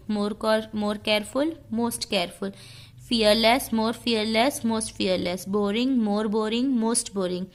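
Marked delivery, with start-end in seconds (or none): none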